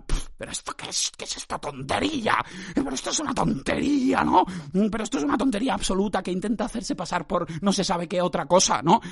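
mock a fussy objector; complaining listener. Podcaster imitating listeners calling it nonsense, mock whining. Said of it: "los oyentes que digan".

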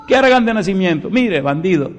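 quick, high, mocking echo; scornful retort. Preacher scoffing at a lazy man's excuse, fast and high. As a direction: throw the excuse back in scorn.

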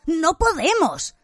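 high, drawn-out alarmed objection; frightened protest. A narrator's reading. Ron stops Harry turning back, frightened. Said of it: "blanco como una pared".

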